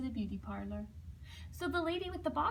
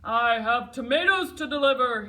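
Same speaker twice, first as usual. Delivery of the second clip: loud shout in a gruff put-on voice; 12 dB louder than usual. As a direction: bellow it at the traffic. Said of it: "he shouted".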